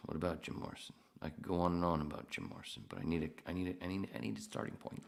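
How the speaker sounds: ordinary delivery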